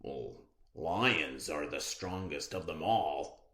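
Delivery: lion's voice. Acted. Teacher voicing the lion in the fable, low lion voice. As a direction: speak as the proud lion. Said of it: "said the lion".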